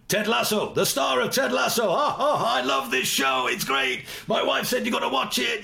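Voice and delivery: imitating a starstruck fan meeting you; mock-thrilled high-pitched fan shout; excited gushing greeting. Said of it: "and he went".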